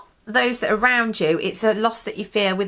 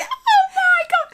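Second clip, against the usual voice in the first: very high, drawn-out mock squeal; hammed-up cringing laughter in the voice; imitating viewer's reaction; 4 dB louder, slower than usual. Co-host imagines Scottish viewer reacting, high mock squeal. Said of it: "She'll be there going".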